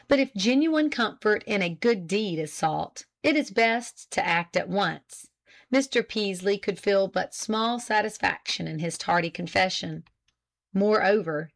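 plain reading voice

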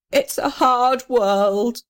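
high, very slow sob; sobbing. Acted. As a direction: squeeze out a tearful sigh. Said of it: "sobbed the little red house".

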